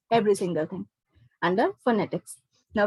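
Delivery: ordinary delivery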